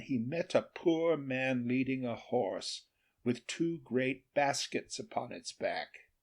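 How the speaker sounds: usual speaking voice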